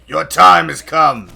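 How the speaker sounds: slow, loud menacing taunt; slow, loud menacing villain voice; dramatic villain line. Pretend. Taunting enemy in game.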